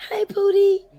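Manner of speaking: put-on high whispered baby talk; breathy girlish whisper. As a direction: whisper it sweet and girly, mocking. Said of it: "You know, like".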